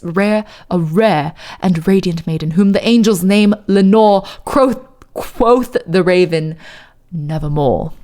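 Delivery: solemn posh British recital, low final word; fake posh British accent. Streamer reciting of lost Lenore, fake posh British accent. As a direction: intone in put-on British, sinking on the refrain. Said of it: "my fake ass posh British accent".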